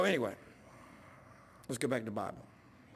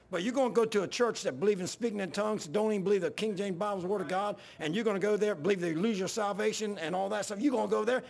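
raised, higher-pitched heated rebuke; worked-up retelling of a telling-off; retold yelling; 6 semitones higher, 3 dB louder than usual. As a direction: recount yelling it down the phone. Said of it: "by this time, I'm yelling".

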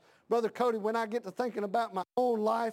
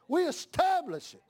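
preaching cry; 7 semitones higher than usual. Real, not acted. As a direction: ring it out high. Repeat of Paul's words.

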